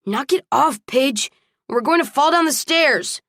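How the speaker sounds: high, alarmed scolding; exasperated warning. A narrator's reading. Man carrying kicking woman up stairs, exasperated.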